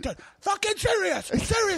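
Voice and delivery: high-pitched mock protest; hammed-up indignant friend; impression of a friend. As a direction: squawk it in a strained high voice. Imitating Bobby's outburst.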